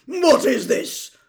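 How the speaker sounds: loud, slow, growled demand; furious, drawn-out roar; angry growl. A narrator's reading. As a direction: growl it at full volume. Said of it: "growled Uncle Vernon".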